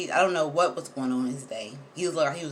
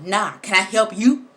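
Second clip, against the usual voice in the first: louder, slower challenging retort; defiant comeback; 3 semitones higher, 9 dB louder than usual. Retold or imitated. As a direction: throw the question back at her. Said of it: "he was still like".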